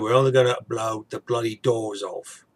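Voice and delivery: a light Cockney take on Michael Caine's famous line; mild Michael Caine Cockney accent; Michael Caine impression. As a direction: quote the film line with a Michael Caine twang. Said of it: "I usually more like the Michael Caine".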